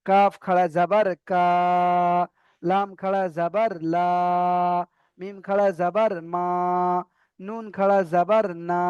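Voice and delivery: slow letter drill, each final "কা", "লা", "মা" stretched in open aa; drawn-out vowel. Shown as a demonstration. Teacher drills the remaining letters, final "কা", "লা" drawn out. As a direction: hold the last syllable, as in "লা", long and even. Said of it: "একালি পরিমাণ টান হবে".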